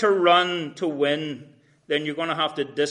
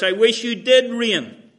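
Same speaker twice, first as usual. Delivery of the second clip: loud, higher biting sarcasm; sarcastic; 6 semitones higher, 8 dB louder than usual. Retold or imitated. Paul needling the Corinthians, loud sarcastic jab. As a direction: throw the wish back at them mockingly. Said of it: "And it's just all sarcasm".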